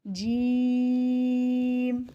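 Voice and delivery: جیم stretched slowly over several beats, nasal m held; long drawn jeem. Shown as a demonstration. Quran teacher drawing out جیم for students. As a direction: hold the م of جیم for three to five counts. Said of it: "تین سے پانچ الف کے جتنا کھینچ کے".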